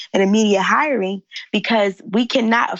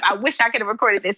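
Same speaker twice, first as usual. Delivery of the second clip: ordinary talk, nothing marked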